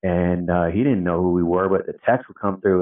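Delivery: usual speaking voice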